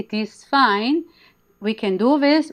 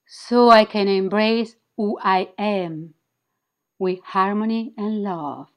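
guided affirmation. Acted. Leads spoken affirmations to repeat.

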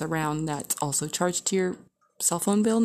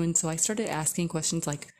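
normal talking voice

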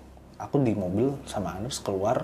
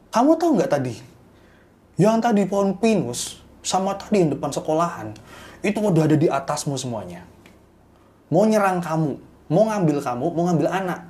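higher, louder insistent warning; urgent warning; 6 semitones higher, 5 dB louder than usual. Retold or imitated. Mbak Siwi warning the couple they are in danger, urgent and raised. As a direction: scold them for breaking the custom.

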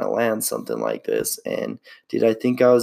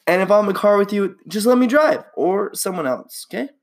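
higher, rushed plea; to bad-driver listeners, higher and quick; fast excited plea; 10 semitones higher than usual. Real, not acted. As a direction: urge it fast and eager.

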